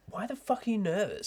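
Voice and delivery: high, incredulous self-reproach; a high mock self-rebuke; mock self-scolding. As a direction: scold yourself in disbelief.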